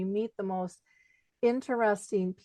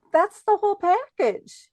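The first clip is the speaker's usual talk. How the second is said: pitched way up and louder; excited exclaim; 12 semitones higher, 7 dB louder than usual. Real, not acted. Speaker to her guest, an excited high exclaim. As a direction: burst out with delight. Exclaims about the audience's tears and laughter.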